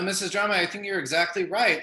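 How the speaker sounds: usual speaking voice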